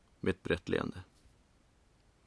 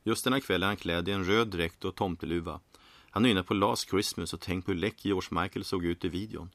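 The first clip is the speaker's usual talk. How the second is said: ordinary delivery, nothing marked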